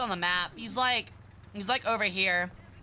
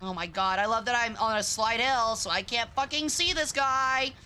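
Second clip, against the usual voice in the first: ordinary talk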